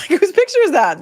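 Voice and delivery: sudden high, loud question; surprised. Retold or imitated. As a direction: blurt it out startled. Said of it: "I'm like".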